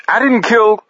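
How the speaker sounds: high, desperate protest; panicked denial. A narrator's reading. Paris protesting to gunman Jay, panicked. Paris denies killing at gunpoint.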